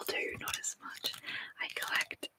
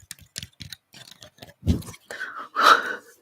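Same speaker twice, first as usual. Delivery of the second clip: sharp loud gasp of real fright; a sudden shocked intake of breath; startled gasp; 9 dB louder than usual. Real, not acted. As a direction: jump at the noise and gasp. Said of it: "bumped the mic and scared the shit out of myself".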